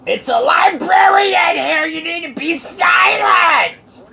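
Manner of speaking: very high, loud drawn-out shriek; screeching librarian. Acted. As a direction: scream for silence like an unhinged librarian. Mock librarian screaming for silence.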